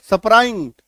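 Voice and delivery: स्प्राइंट split into "sa-pa-raint"; mispronounced cluster. Shown as a demonstration. Teacher shows students a wrong reading, स्प्राइंट mispronounced as "sa-pa-raint". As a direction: add vowels inside the opening consonants of स्प्राइंट. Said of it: "गलत हो जाएगा".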